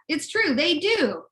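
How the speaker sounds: ordinary delivery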